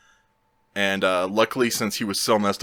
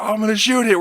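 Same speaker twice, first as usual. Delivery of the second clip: high-pitched mock screamed threat; screaming; 12 semitones higher, 4 dB louder, faster than usual. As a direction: taunt back in an imitated yell. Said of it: "we're just screaming back and forth".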